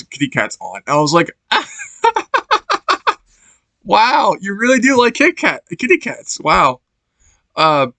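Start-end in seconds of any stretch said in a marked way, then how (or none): 1.5-3.1 much higher burst of real laughter. Real, not acted. Laughs at kitty cat underpants. 3.8-6.8 higher, lightly teasing wow; mock teasing. Mocks villain's kitty underpants.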